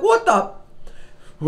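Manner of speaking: squeaky stunned gasp, way up high; shocked what the. Retold or imitated. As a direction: shriek it in disbelief. Shocked at soy milk in box.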